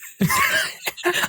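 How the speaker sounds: real high-pitched cackling laugh; high laughing burst. Real, not acted.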